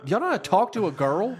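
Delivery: loud, high, put-on teasing voice; hammed-up high-pitched mock question; mocking incredulity. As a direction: tease an awkward kid with exaggerated disbelief. Mock question to awkward teens.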